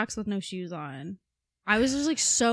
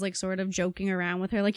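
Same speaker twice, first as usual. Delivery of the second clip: normal talking voice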